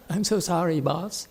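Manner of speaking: a hint of a frightened worker begging not to be fired; mock pleading. Master imitates the student begging the boss for his job. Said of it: "but he said".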